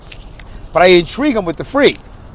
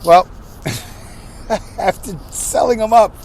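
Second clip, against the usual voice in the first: normal talking voice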